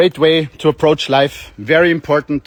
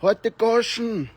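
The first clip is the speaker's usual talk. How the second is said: a slow, higher mock German grumble; mock German neighbour; 5 semitones higher, 3 dB quieter, much slower than usual. Neighbour shouting from his window in mock German. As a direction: put on a German neighbour's voice, drawn out. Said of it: "They're like".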